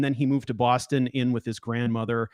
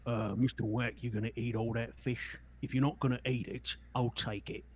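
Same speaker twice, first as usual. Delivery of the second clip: a lightly voiced, softer greedy companion; a hint of a sly companion, quieter; Twist's voice; 5 dB quieter than usual. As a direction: eye the fish and ask for it. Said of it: "Twist would say something to the effect of".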